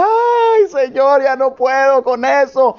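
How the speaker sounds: high wailing ay then tired plea; mock lament. Preacher retelling his own complaint, wailing. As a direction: groan it out to the Lord.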